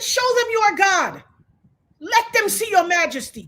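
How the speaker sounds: high, ringing plea to God; retold preacher-like cry; fervent prayer. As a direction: proclaim it with bold faith. Retold bold prayer on plane.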